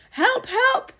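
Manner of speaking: high, loud cries for help; desperate cry. Acted. Drowning man calling to the shore for help. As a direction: yelp it up high, panicking.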